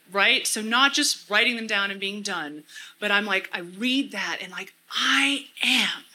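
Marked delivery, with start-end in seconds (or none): 4.9-6.0 I AM drawn out slowly, each word weighted; stress on I AM. Shown as a demonstration. Speaker affirming who she is in scripture, slow emphatic I AM. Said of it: "emphasizing different words to really get that".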